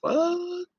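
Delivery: high, slow incredulous cry; 1 stunned disbelief. Retold or imitated. Reacting to women accusing him, slow stunned disbelief. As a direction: stretch it out, utterly stunned. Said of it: "And I'm like".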